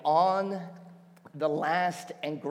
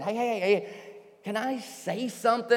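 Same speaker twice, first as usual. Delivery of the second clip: raised, higher-pitched call for attention; loud voice; 7 semitones higher than usual. Acted. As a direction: call out over a chanting crowd. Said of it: "says this in a loud voice".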